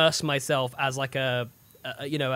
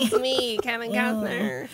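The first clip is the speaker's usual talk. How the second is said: Kevin Costner; 4 semitones higher, slower than usual. Retold or imitated. King voiced as a celebrity, lightly imitated. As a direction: lightly mimic a drawling star. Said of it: "Kevin Costner sounding like this".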